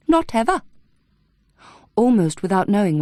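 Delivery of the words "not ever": much higher, smiling and sinister; eerie smiling finality. A narrator's reading. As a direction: smile through it, chillingly. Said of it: "with a sudden smile".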